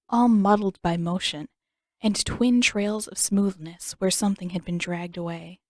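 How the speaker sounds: ordinary delivery, nothing marked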